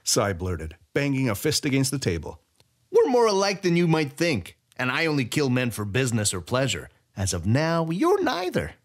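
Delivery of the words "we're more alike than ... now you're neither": put-on gloating thief greeting an old rival; gleeful Sai. A narrator's reading. Sai voiced by narrator.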